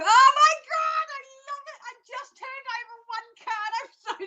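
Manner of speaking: squealing high with delight, slowing on Oh my God; overjoyed gasp at the turned card; real excitement. Real, not acted. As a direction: burst out thrilled at the card. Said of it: "I got really excited".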